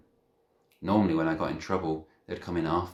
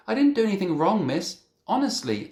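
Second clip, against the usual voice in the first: put-on high, pleading child voice; 8 dB louder than usual. A narrator's reading. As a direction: beg to be believed, voice raised high. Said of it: "I pleaded".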